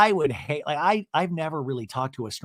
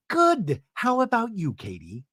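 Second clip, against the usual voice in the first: mock forced politeness; 6 semitones higher than usual. Heather answering Katie whom she dislikes, pointed high reply. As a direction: start high and pointed, drop on the name. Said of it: "And she goes".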